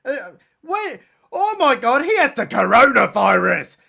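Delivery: loud, high gasp of fake shock; hammed-up horror; mock shock. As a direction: blurt it like a big reveal.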